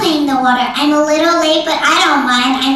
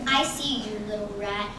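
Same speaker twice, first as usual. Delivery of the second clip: much softer, lower on little rat, a creeping hunter; cat softly menacing the hidden rat; sly quiet threat; 5 semitones lower, 12 dB quieter than usual. Acted. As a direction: hush it like a cat stalking prey.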